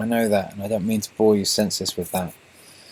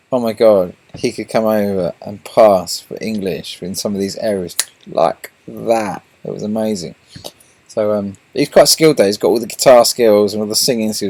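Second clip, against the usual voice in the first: excited; 7 dB louder than usual. Real, not acted. Raving about friend's skills.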